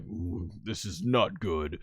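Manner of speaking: low, quiet, slow worry; grim onlooker muttering under his breath; soft, deep character imitation; Piccolo. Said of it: "And Piccolo's like".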